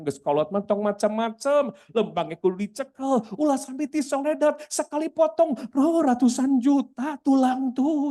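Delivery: high-pitched Sundanese naysayer; mock dismissive advice, climbing high; voice of public opinion. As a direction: mimic a scolding doubter, pitched right up. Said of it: "Itu opini umum".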